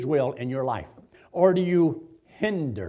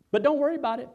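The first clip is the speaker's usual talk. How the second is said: normal talking voice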